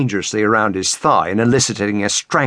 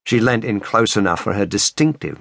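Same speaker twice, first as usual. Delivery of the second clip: ordinary delivery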